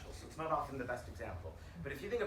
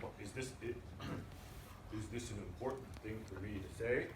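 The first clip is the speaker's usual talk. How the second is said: a hint of a hesitant CEO, softer and lower, clearing his throat; 5 semitones lower, 6 dB quieter than usual. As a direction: imitate the unsure CEO, a touch lower. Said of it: "He's like".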